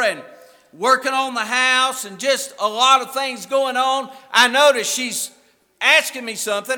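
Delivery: loud, driving pulpit delivery; full-voiced preaching. Real, not acted. Preacher telling the church about his wife, full-voiced preaching. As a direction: preach it out with force.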